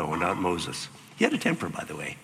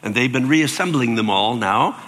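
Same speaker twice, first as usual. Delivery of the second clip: ordinary delivery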